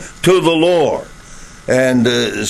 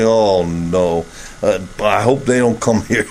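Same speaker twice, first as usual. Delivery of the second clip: lower, groaning dread; mock groan; 8 semitones lower than usual. Preacher imitates a reluctant host groaning over an unwelcome visitor. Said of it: "groan and say".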